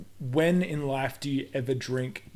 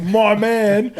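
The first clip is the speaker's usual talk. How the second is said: higher, louder, laughing bro mockery; 7 semitones higher, 12 dB louder than usual. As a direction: hype up a dumb stunt. Mocking bro praise, laughing.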